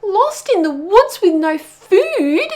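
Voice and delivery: high, slow, loud wail. Acted. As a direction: wail it out in despair. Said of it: "wailed Pog".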